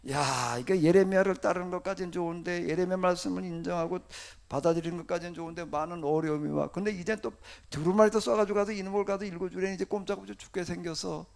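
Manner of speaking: ordinary talk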